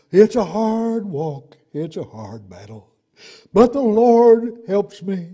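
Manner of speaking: mock lament, slow and drawn-out; struggling believer. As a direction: groan out the hardship, swelling on key phrases.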